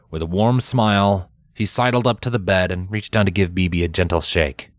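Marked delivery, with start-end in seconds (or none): none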